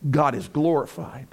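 ordinary delivery

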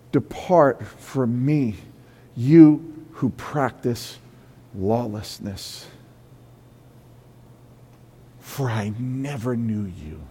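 slow, quiet, solemn pronouncement; the Lord's judgment. Acted. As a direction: pass final judgment slowly and softly. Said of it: "and he says".

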